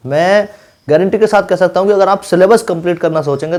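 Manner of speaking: normal talking voice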